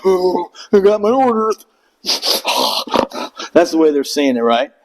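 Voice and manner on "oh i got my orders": high, sheepish voice; meek recruit. Retold or imitated. Recruit answering mocking stares, high and sheepish. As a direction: sound innocently clueless. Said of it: "And I'm like".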